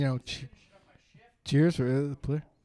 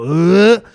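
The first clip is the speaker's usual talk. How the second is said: loud imitated retch; vomiting; 3 semitones higher, 14 dB louder than usual. Mitchell throwing up into the wrong bag, loud mock retch. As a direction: heave out a mock puking sound. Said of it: "I just hear him go".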